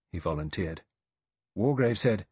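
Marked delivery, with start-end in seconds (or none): none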